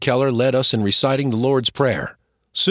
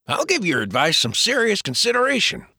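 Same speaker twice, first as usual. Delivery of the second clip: higher, playful man's voice; teasing James; 8 semitones higher than usual. Acted. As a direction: tease him like an old friend.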